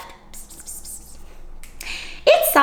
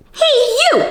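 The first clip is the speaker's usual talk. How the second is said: much higher, louder hey you; grouchy scream; 5 dB louder than usual. Acted. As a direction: yell it like a picky little bully bug. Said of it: "screamed the grouchy ladybug".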